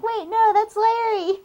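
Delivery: high, sudden correction; imitating a friend's quick realisation; high-pitched playful that's Larry; mock correction. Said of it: "You're like".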